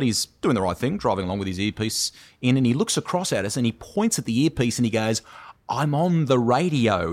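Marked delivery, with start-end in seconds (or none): 5.7-7.1 slow, raised, over-articulated delivery; imitating someone silently mouthing words; exaggerated mouthing. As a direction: mouth each word carefully to someone across traffic. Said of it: "He sort of mouths the words".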